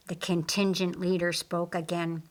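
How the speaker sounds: ordinary delivery, nothing marked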